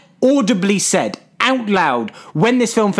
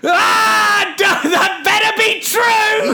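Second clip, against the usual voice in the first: high, drawn-out mock roar; imitated slow, furious yell; angry; much slower than usual. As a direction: shout it in hammed-up rage. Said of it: "he's angrily going".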